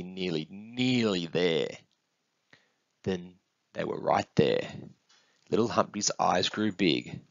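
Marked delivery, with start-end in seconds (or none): 0.0-1.7 slow, stretched words; lingering, suspenseful reassurance; teasing build-up. Acted. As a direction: draw out the promise of arriving. Parent builds up to arrival.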